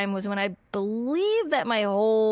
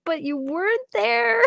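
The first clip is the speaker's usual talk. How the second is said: mild retold crying; 11 semitones higher, 3 dB louder than usual. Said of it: "I started crying".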